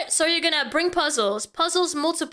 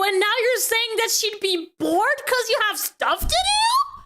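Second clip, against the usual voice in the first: raised, incredulous scolding at an absent guy; exasperated rant; 5 semitones higher than usual. Real, not acted. Reading his DMs, she scolds the guy, indignant. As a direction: scold him like he's standing right there.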